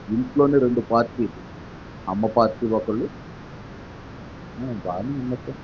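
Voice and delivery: normal talking voice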